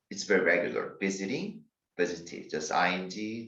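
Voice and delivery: ordinary delivery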